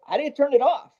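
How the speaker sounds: high-pitched, puzzled asking; retold baffled question. Visiting engineer asks about runaway reverb, bewildered.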